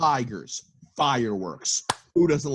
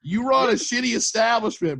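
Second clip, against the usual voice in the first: higher, louder mock heckle; 12 semitones higher, 4 dB louder than usual. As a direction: jeer at him from a boat.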